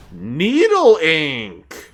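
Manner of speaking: very slow, stretched out; drawn out. Real, not acted. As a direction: stretch the name out. Drawn-out item name.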